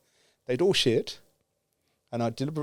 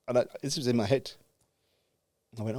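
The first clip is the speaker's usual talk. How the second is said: usual speaking voice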